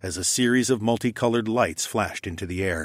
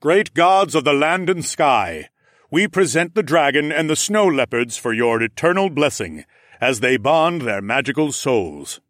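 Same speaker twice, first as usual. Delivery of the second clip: solemn declaration; 5 semitones higher, 5 dB louder than usual. Acted. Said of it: "Rodion declared".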